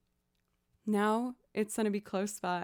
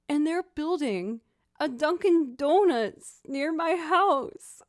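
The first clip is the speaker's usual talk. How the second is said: high, louder, rising excitement; excited telling; 7 semitones higher, 6 dB louder, slower than usual. Real, not acted. Telling viewers about the new Dunkin', high and excited. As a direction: spill the news, can't contain it.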